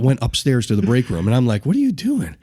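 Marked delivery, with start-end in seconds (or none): 1.7-2.4 high, incredulous question; pitched-up disbelief; acted bafflement. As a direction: act out being baffled. Acts his baffled younger self.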